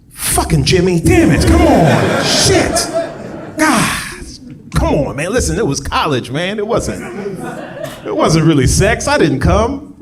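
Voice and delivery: high, loud, frantic pleading; panicked blood donor. Retold or imitated. Man filling the form begs for the blood money, frantic. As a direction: beg the nurse like a desperate man. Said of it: "there's somebody who gets to that question and they're like".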